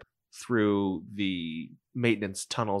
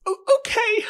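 very high, squeaky and small; 6 dB louder, much slower than usual. Acted. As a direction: squeak it out like a tiny droid. NPC hangs up comm.

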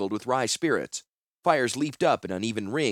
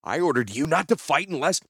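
higher-pitched, indignant reprimand; angry rebuke; 6 semitones higher than usual. A narrator's reading. Commander scolding a disobedient crossbow master, raised indignant voice. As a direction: rebuke him, voice pushed up.

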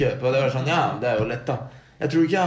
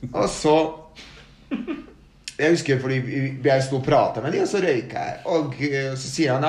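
ordinary talk, nothing marked

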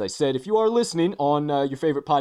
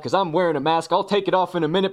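ordinary delivery

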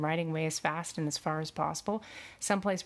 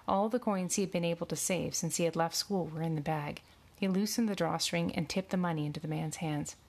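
ordinary delivery

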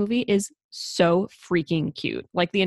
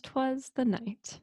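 soft, slow, higher-pitched title reading; hushed title sign-off; 6 semitones higher, 9 dB quieter, much slower than usual. Acted. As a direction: announce the title softly and slowly. First take of title sign-off.

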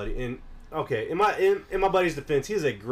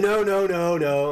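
insistent denial; 6 semitones higher, 6 dB louder, slower than usual. Retold or imitated. Retold buddy denying it.

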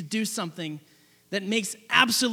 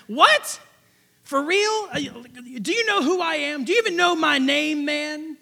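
higher, indignant "what, for real?"; outraged disbelief; 4 semitones higher, slower than usual. Retold or imitated. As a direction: play the offended general who can't believe it. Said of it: "of course, he's like".

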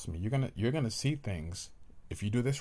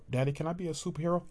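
put-on higher, fast eager kid asking dad; child voice; 7 semitones higher, much faster than usual. Child asking daddy, in a raised kid voice. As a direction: play the small child begging daddy.

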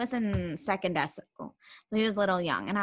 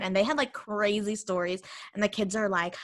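ordinary delivery

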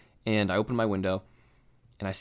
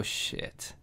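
whispered dread; 12 dB quieter than usual. Retold or imitated. Seatbelt light comes on.

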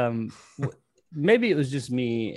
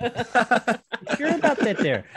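put-on much higher, sing-song Wisconsin accent; 11 semitones higher, slower than usual. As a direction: play a chirpy Midwestern genie. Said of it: "Wisconsin accent".

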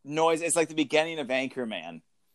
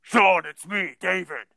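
rough voice through a handkerchief; gruff words muffled by cloth; 3 semitones higher, 3 dB louder than usual. Shown as a demonstration. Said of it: "rough voice muffled".

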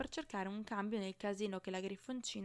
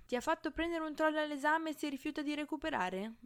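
ordinary delivery

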